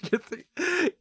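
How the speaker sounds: breaking up laughing mid-word. Real, not acted.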